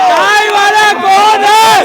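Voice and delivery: high, very loud drawn-out shout; real full-voiced protest yell; shouted protest taunt. Real, not acted. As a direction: holler it slowly like a slogan.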